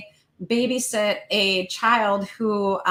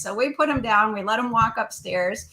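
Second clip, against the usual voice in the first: ordinary talk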